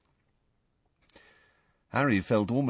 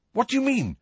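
higher, quick sharp question; quickly; faster than usual. Acted. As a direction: shoot the question back fast. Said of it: "said Harry quickly".

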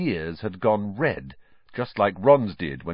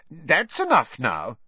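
higher, slow motherly warning; firm scolding; 8 semitones higher, 3 dB louder, slower than usual. A narrator's reading. Mother interrupting her twins' teasing, firm scolding. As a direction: put a stop to the silliness.

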